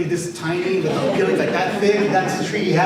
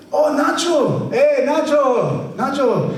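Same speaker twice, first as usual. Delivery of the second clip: high, loud, stretched greeting call; calling back; 10 semitones higher, 4 dB louder, much slower than usual. Retold or imitated. Grandpa calling back to his neighbour, loud and high. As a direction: shout a happy hello across the yard.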